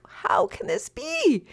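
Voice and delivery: very high, drawn-out disbelief; incredulous. Retold or imitated. Sibling told the letter is impossible, incredulous. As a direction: stretch it out in high-pitched, hammed-up dismay. Retelling own disbelief at brother's refusal.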